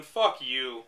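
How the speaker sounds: ordinary talk, nothing marked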